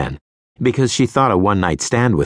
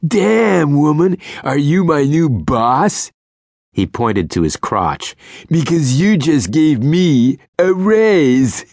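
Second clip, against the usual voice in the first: higher, slow leering charm; sleazy flirt voice; 8 semitones higher, 3 dB louder, slower than usual. A narrator's reading. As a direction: lay on the cheese. Sleazy guy pickup.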